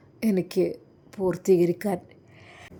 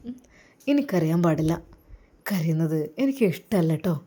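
ordinary talk, nothing marked

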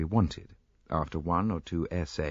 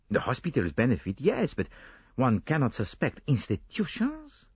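arguing Poirot; 7 semitones higher than usual. Acted. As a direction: brush aside Hastings' guess. Poirot figure voice.

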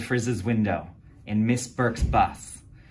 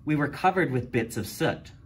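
ordinary delivery, nothing marked

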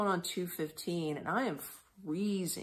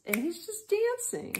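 pitched far higher, light and playful; high sing-song; 14 semitones higher than usual. Retold or imitated. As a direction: sing it up high, amused by his dancing. Playful retelling of dancing man.

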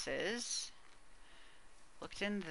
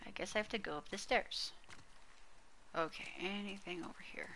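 barely above a breath; nervous hush; 14 dB quieter than usual. Real, not acted. Nervous horror game, very quiet.

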